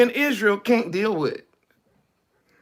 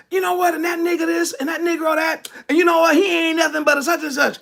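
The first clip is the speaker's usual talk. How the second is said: high, loud mock banter among friends; fired-up, high-pitched imitation of folks fussing; loud animated talk; 9 semitones higher, faster than usual. Speaker mimicking people talking among themselves, loud and animated. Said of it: "you be loud".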